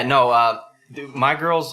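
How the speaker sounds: ordinary talk, nothing marked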